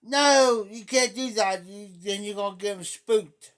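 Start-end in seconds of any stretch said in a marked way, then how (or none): 0.0-1.6 loud, high mock panic; imitating a scared parent. Mimicking a fearful parent, mock panicked and loud. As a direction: ham up the parent's fright. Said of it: "you would say".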